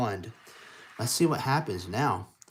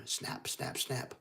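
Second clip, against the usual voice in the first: whispered camera snaps; 7 dB quieter, slower than usual. Retold or imitated. Camera sound effect.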